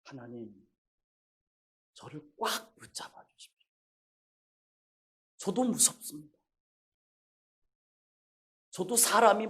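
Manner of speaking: soft, near-whispered plea to God; hushed prayer. Retold or imitated. Father praying quietly to God, hushed and humble. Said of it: "하나님께 그렇게 기도했습니다".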